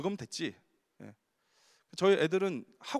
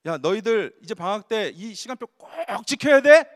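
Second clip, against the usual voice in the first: loud, slow mock teacher's order; kindergarten teacher; 11 dB louder, slower than usual. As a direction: boom it out like a strict teacher. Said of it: "유치원 선생님이 청천벽력 같은 소리". (유치원 선생님 흉내, 크고 느리게)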